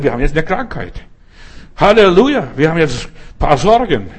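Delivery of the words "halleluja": loud, high Halleluja called out; proclaimed Halleluja. Acted. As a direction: shout praise over the trouble. Proclaiming Halleluja over problems. (laut und hoch ausgerufenes Halleluja)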